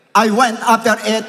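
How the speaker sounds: fervent preacher reading; preaching at full force. Acted. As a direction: proclaim it like a battle cry.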